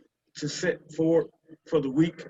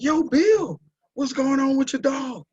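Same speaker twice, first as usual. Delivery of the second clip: raised, much higher hail across the yard; calling out; 9 semitones higher, 4 dB louder than usual. Retold or imitated. Calling to neighbor Bill about his moaning dog, raised hail. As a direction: call over the fence to Bill.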